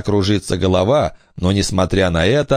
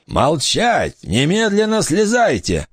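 barked order; 9 semitones higher than usual. A narrator's reading. Pirate orders children down.

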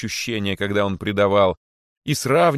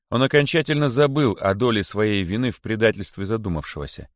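plain reading voice

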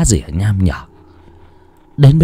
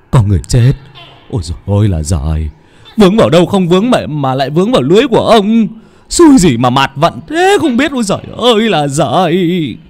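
high, loud wail of cursing; lamenting curse; 7 semitones higher, 4 dB louder than usual. A narrator's reading. Fisherman cursing his luck over a corpse in his net, wailing. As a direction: rattle out the complaint, climbing into a wail. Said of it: "buột miệng lẩm bẩm chửi thề".

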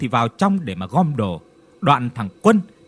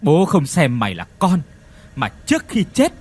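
bitter growl. Acted. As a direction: spit it out through clenched resentment. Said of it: "gằn giọng uất ức". (giọng gằn uất ức, to dần)

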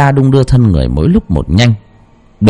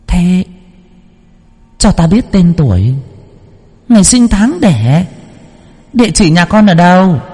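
put-on high, piercing child's voice; shrill child voice; 9 semitones higher than usual. Possessed medium speaking to worshippers as a child spirit. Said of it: "lanh lảnh như là đứa trẻ con".

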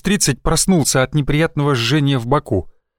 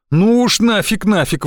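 indignant; 6 semitones higher than usual. A narrator's reading. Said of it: "возмутился я".

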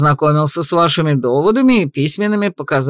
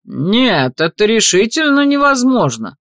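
higher, insistent protest; loud protest; 6 semitones higher than usual. A narrator's reading. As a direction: object loudly, indignant. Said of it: "громко запротестовал алый судья".

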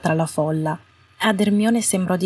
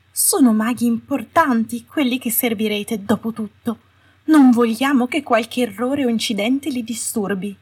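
sugary Umbridge; 5 semitones higher than usual. A narrator's reading. Umbridge finishes her speech.

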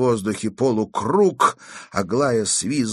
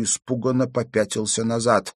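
ordinary delivery, nothing marked

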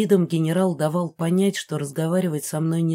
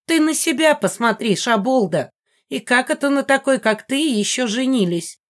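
shrill mocking jab, higher and louder; 7 semitones higher, 3 dB louder than usual. A narrator's reading. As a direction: sneer at her with scorn. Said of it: "заметила Мегара с насмешкой".

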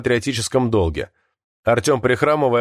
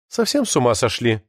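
ordinary delivery, nothing marked

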